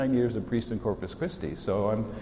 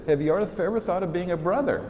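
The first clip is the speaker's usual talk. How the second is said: ordinary talk, nothing marked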